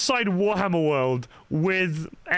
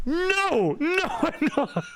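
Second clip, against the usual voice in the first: much higher, laughing no; high, giggling denial; laughing refusal; 13 semitones higher, slower than usual. Retold or imitated. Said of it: "And I'm like".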